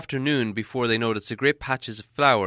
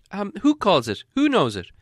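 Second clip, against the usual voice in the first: high, timid little hobbit voice; curious hobbit; 9 semitones higher, 4 dB louder than usual. Acted. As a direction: ask it up high, shy and curious. Hobbit asks again.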